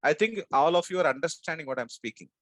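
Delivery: usual speaking voice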